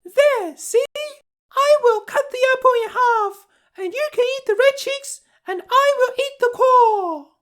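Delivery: put-on very high, coaxing old crone voice; the wicked queen hiding as a sweet old lady; disguised old woman. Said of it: "cried the old woman".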